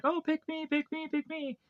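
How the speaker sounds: high, squeaky begging at normal volume; put-on high kid voice, all on one high note; imitating eager kids. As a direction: pipe up like a crowd of pleading children.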